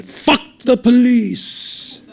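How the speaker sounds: slow, loud, high yell; taunting yell. A narrator's reading. Taiho yelling after the fleeing cop, slow taunt. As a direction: drag each word out as an insult.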